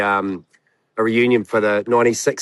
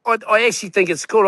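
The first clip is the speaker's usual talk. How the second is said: usual speaking voice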